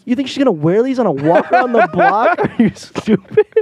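high, laughing mock scolding; laughing tease. Mocking her dad's gift idea, high and laughing.

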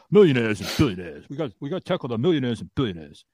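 sneering imitation of a politician's slogan; mock stump-speech voice; mocking Bernie Sanders. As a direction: mimic him like a campaign speech. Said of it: "that's the man that says".

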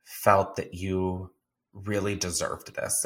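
lightly voiced mom, soft and sincere; a hint of mom being heartfelt; tender. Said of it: "she's like".

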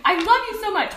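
high, loud scolding dog owner; sweet-scold the dog; talking to dog. Retold or imitated.

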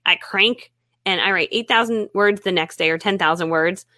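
ordinary talk

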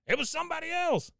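very high put-on protest; hammed-up cry of innocence; [name]. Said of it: "you hear [name]'s go".

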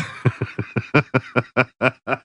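high wheezing laughter; real laughing fit. Real, not acted. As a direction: crack up helplessly. Cracking up at own story.

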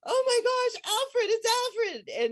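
high, loud squeal of a name; excited scream. Retold or imitated. Spotting the whale, screaming his name to her friend with joy. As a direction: shriek the name at the sight of the whale. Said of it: "I start screaming and jumping up and down".

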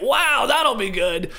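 high, slow, delighted wow; genuinely pumped. Shown as a demonstration. Host showing the sincere reading of a player's reaction, pumped. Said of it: "Or you can say it".

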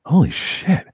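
amazed. Retold or imitated. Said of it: "I was like".

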